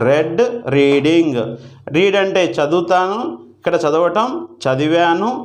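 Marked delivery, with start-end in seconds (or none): 0.1-0.4 "రెడ్" with a short e, like red; past participle READ. Shown as a demonstration. Teacher reciting the third verb form, "రెడ్" with short e. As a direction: rhyme "రెడ్" with bed. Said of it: "రెడ్ అని చదవాల్సి ఉంటుంది".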